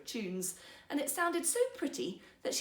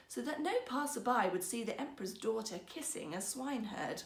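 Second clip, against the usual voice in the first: ordinary delivery, nothing marked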